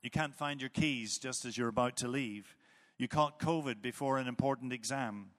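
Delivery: ordinary talk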